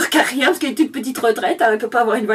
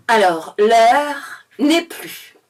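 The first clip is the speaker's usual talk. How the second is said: very slow, emphatic proclamation; mock-solemn official speech, drawn out; minister's speech redone; 4 dB louder, much slower than usual. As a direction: spell it out loudly, word by word. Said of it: "refaire le discours du monsieur en étant un petit peu plus clair".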